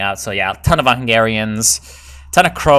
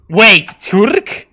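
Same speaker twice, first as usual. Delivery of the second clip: high, loud disbelieving question; can't believe the Turks are coming; incredulous; 8 semitones higher, 6 dB louder than usual. Real, not acted.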